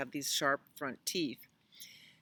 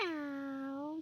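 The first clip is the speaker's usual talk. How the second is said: high, soft, drawn-out meow; meowing cat; 10 semitones higher, 6 dB quieter than usual. Acted. Cat answers Cow in a story, meowing. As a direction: mew long and softly like a cat. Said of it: "said Cat".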